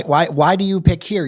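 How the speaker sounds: ordinary delivery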